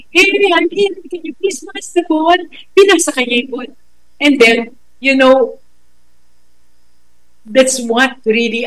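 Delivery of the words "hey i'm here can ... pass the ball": put-on high, bright little-kid ask; child asking nicely. Preschooler asking playmates for the ball, high and polite. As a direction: sweetly call out to the other kids. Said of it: "when he tried to tell them".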